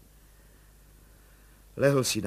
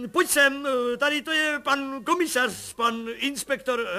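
much higher and louder, joyful calls; overjoyed shouting; 13 semitones higher, 10 dB louder than usual. A narrator's reading. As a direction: shout for joy to the wife. Overjoyed colonel calls his wife.

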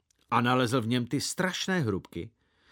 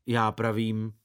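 ordinary delivery, nothing marked

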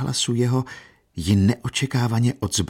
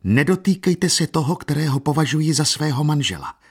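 raised, proud warning; vehement and proud; 7 semitones higher, 3 dB louder than usual. A narrator's reading. Katerina defending the man she loves, vehement and proud. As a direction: defend him fiercely in a high voice. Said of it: "zvolala Kateřina prudce a hrdě".

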